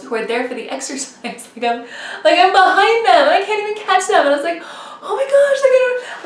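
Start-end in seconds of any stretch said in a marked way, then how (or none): 2.2-4.2 retold despair. Retold despair at being behind. 5.0-6.2 very high mock wail; retold despair. Racer behind the stroller ladies, despairing.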